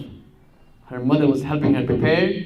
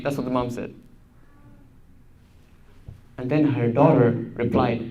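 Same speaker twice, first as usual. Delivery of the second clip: ordinary delivery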